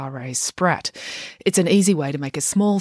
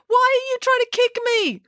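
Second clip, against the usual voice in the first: very high, panicked yell. A narrator's reading. Finn thinks April is kicking him, yelling. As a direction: demand it in a high squeal. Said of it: "yelled Finn".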